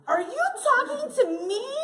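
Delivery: high, loud, indignant question; escalated offended challenge. Retold or imitated. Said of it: "you're like".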